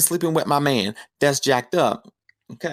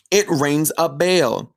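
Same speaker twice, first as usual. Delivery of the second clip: ordinary delivery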